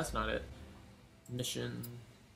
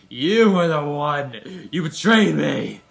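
mock accusation; 11 dB louder, slower than usual. Accusing game character.

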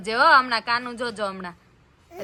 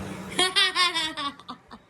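laugh; 6 semitones higher, 4 dB quieter than usual. Real, not acted. Laughing at own joke.

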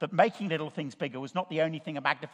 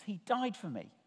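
under your breath; 3 semitones higher, 7 dB quieter than usual. Shown as a demonstration. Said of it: "just under your breath".

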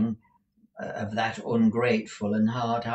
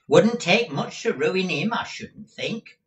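a higher, grinning Squeers; with a grin; 9 semitones higher, 7 dB louder than usual. A narrator's reading. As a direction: sneer it with a grin. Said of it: "said Squeers with a grin".

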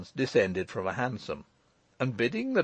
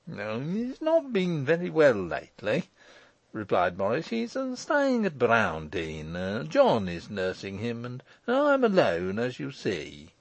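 evasive Morris. Acted. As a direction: stall uneasily with little fillers.

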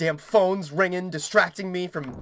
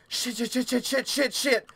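high, hushed stammer of curses; panicked; 5 semitones higher, 3 dB quieter than usual. Real, not acted. Player cursing to self mid-game, panicked. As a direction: spit out a frantic string of curses.

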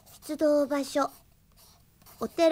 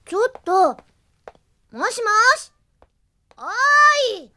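higher, louder call building to a shout; calling after him; 6 semitones higher, 6 dB louder, faster than usual. Acted. Officer calling after her departing partner, rising to a loud call. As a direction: call him back as he walks away.

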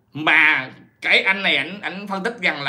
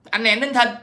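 mock taunt as Hằng; 8 semitones higher than usual. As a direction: call out the name like a taunting challenge. Voices Hằng calling out the name. (giọng nhại chị Hằng khiêu khích)

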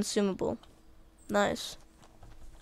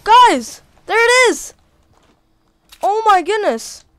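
loud, high thrilled yelling; excited shouting; 11 semitones higher, 13 dB louder, slower than usual. Real, not acted. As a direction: shout with pure joy at the find. Finds the treasure in game.

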